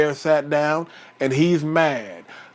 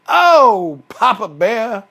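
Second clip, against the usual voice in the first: loud, raised wail to a husband; Mama Bear calling out; 3 semitones higher, 6 dB louder, slower than usual. Acted. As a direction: call out in dismay, big on the oh. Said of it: "call out".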